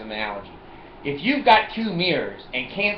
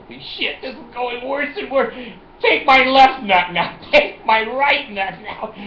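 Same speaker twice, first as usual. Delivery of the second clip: mock panic; 9 semitones higher, 5 dB louder than usual. Inner panic, mock surrender.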